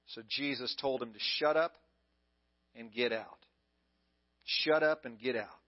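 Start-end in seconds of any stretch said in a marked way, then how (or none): none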